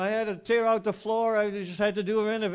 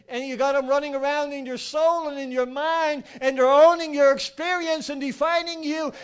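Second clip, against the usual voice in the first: loud, higher, driving sermon voice; fiery preaching; 5 semitones higher, 9 dB louder than usual. Acted. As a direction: hammer the point home to the congregation. Preaching at full force.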